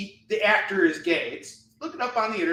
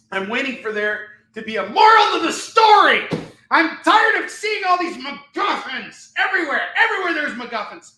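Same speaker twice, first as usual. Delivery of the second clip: loud, laughing mock tirade; retold rant to son; 3 semitones higher, 8 dB louder than usual. Dad ranting to his son at the movie. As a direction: rant at the kid half-joking. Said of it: "And I was like".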